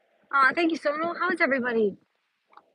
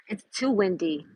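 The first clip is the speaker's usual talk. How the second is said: normal talking voice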